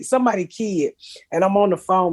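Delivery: ordinary talk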